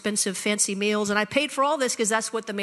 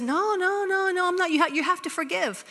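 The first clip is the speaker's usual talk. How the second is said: pleading friend; 8 semitones higher than usual. Retold or imitated. Friend protesting, retold.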